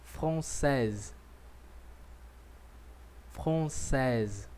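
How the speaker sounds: française with the final z sounded; française said twice for the class to repeat; model for repeating. Shown as a demonstration. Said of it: "repita comigo agora".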